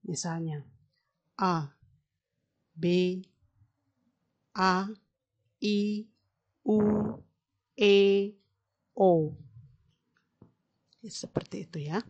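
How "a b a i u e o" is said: A, B, A, I, U, E, O each sounded fully and separately; clear vowel articulation. Shown as a demonstration. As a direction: shape every vowel openly, one at a time. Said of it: "contoh dari artikulasi seperti ini". (vokal A, I, U, E, O diucapkan jelas satu per satu)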